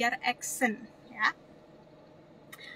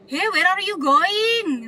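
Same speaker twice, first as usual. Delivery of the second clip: put-on loud, high, drawn-out Javanese-accented English; medok Jawa; 7 semitones higher, 9 dB louder, much slower than usual. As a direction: exaggerate a thick Javanese accent, calling out slowly. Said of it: "Nah, kayak gitu-gitu". (logat Jawa medok, tinggi, keras, diulur)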